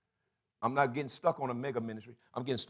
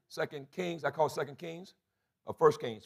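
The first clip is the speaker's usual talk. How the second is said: normal talking voice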